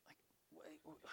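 whispered aside. Real, not acted. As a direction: pull back to a breathy aside. Sudden aside.

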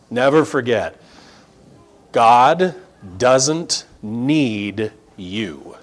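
usual speaking voice

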